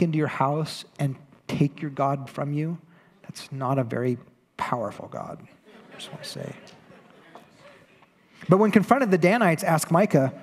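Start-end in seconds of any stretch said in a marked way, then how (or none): none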